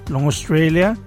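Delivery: ordinary talk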